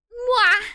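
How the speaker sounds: high loud smooch; loud playful mwah; kiss sound. Acted. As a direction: smack a big kiss.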